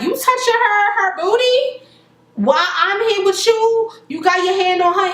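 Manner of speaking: hammed-up jealous fury; mock angry. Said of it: "she getting mad now".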